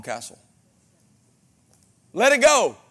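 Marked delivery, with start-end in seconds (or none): 2.1-2.8 preaching shout. Real, not acted. Preacher exhorting congregation.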